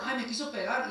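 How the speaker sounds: high mock yell of a complaining child. Child yelling at parent that he tried to hit, mock yell. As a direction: whine it out loud like a tattling kid. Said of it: "Ya nomás le grita".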